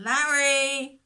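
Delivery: calling out. Acted. Shepherd searching the hillside, calling loudly for his lost sheep. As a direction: shout the name to find him. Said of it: "So he called again".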